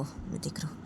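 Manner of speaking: menacing whisper. Acted. Angry boyfriend threatens the boy.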